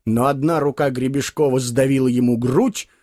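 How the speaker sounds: ordinary delivery, nothing marked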